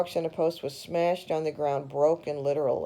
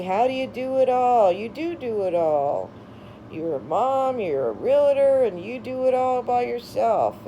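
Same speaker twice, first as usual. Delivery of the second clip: gushing praise; 6 semitones higher, 3 dB louder than usual. Retold or imitated. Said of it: "They'd say".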